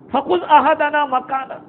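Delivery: slow melodic recitation of the verse; slow Quranic recitation. Acted. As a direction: intone it slowly like tilawat.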